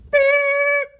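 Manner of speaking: one long, high mock honk; playful high-pitched sustained honk; imitated horn blast. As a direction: hold a high, loud horn beep.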